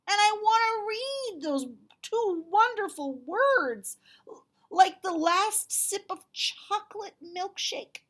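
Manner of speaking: high, loud, eager dragon voice; young dragon whining; Duncan pleading. Acted. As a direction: beg it like an impatient little dragon. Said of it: "said Duncan".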